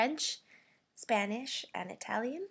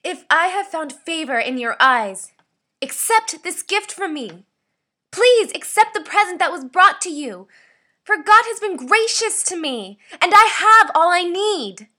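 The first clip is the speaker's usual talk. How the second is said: loud, high furious shouting; angry; 5 semitones higher, 16 dB louder than usual. Shown as a demonstration. Voice actor demanding a gift be accepted, angry shouting. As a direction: shout every phrase in anger. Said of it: "This is my angry read".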